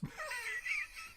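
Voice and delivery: sudden high laugh; real high laugh, cracking up; high burst of laughter. Real, not acted. Laughing at viewer's cheeky message.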